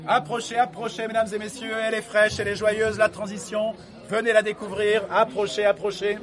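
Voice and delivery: high-pitched fairground barker call; snake oil seller. Acted. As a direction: hawk it like a market crier. (bonimenteur de foire, voix haute)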